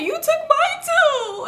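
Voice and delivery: very high, drawn-out whiny wail; mock crying voice. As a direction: squeal it out slowly like tearful pleading. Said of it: "let me talk regular".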